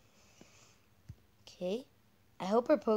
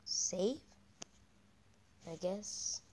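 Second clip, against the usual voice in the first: normal talking voice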